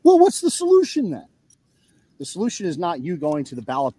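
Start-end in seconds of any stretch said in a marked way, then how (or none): 0.0-1.3 high, mocking squeal; mocking whine. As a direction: squeak it like a whiny critic. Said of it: "people throw a shit fit".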